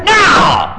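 high bratty shout of no; defiant child's shout. Retold or imitated. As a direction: yell it like a brat.

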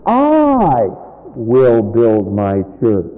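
soar on "I", pause, then drop; big lingering emphasis on "I"; stress on I. Shown as a demonstration. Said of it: "So catch it".

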